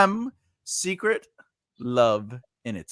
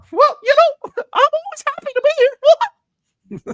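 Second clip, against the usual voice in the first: very high squeaky cartoon mouse voice; Mickey Mouse voice; 7 dB louder than usual. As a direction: chirp it like a cartoon mouse greeting kids. Mickey Mouse babysitter impression.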